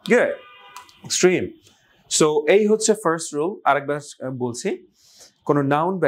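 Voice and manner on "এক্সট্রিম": "এক্সট্রিম" with "trim" high and falling; second-syllable stress. Shown as a demonstration. Teacher gives learners the model once more, second-syllable stress. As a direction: rush "x", hit "trim". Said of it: "স্ট্রেসটা হচ্ছে পরের অংশে".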